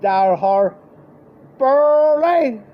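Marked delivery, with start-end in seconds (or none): 1.6-2.6 high, loud call across the street. Retold or imitated. Dad calling to Burley across the street, loud high shout. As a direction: hail him from far off.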